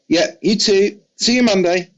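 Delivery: a hint of a chummy rock star. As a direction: toss off a friendly goodbye in a bright imitation. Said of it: "And he's like".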